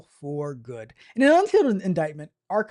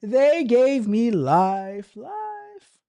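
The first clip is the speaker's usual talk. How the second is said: rising hammed-up lament with the last "life" pitched much higher; mock wail; 5 semitones higher, 3 dB louder, much slower than usual. Newly sentenced convict hamming up his despair, a mock wail.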